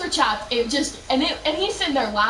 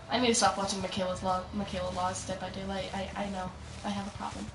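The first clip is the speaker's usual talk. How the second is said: much softer, lower, under the breath; quiet confession; 5 semitones lower, 10 dB quieter than usual. Real, not acted. Admitting a problem with watching a streamer, quiet confession. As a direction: drop down to a small sheepish aside. Muttered self-admission as aside.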